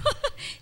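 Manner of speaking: sudden high breathy laugh; high burst of laughter. Real, not acted. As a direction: let a squeaky laugh escape.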